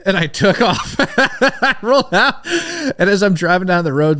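high, loud, slow words through laughter; can't stop laughing at the memory. Real, not acted. Laughing retelling the crash escape.

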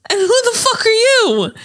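high, loud hammed-up demand; mock-furious movie quote; mock tough-mom yell. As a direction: bark it like a hostile movie mom. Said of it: "And she's like".

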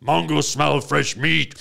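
blunt caveman voice, no articles; caveman talk. Retold or imitated. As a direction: speak like a primitive brute sniffing food. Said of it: "he still talks like this".

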